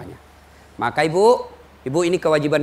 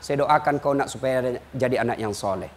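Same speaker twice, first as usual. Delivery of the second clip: put-on lower, softer grandparent reassuring the child; tender grandparent; 6 semitones lower, 6 dB quieter than usual. Grandmother explaining her prayer to the grandchild, tender and soft. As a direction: gently tell the child you pray for him.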